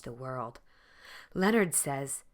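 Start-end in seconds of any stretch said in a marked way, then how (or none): none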